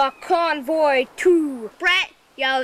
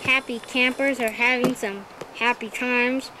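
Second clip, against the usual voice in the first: ordinary delivery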